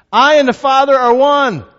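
high, loud shouted declaration; yelled proclamation. Acted. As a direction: proclaim it at a shout to a whole crowd. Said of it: "and he yells out".

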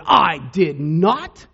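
loud, drawn-out mock outrage; indignant. Patient in the next bed objecting to Moses's claim, indignant. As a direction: stretch each word out loudly in indignation. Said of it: "sat up indignant and said".

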